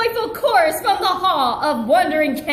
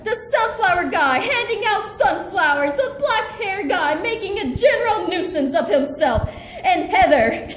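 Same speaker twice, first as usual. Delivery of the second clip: ordinary delivery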